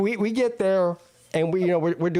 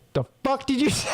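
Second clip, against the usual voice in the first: high-pitched mock challenge; indignant comeback; 6 semitones higher, faster than usual. Friend confronting the waitress over her insult, mock indignant. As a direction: imitate an affronted friend, voice jumping up.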